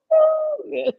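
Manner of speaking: sudden high, loud laugh. Real, not acted. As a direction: burst out laughing. Laughs heartily.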